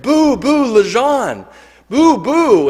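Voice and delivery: high, loud jeering boos; a crowd booing the speaker, loud and high; retold booing shout. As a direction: jeer like a hostile crowd. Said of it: "shouting things".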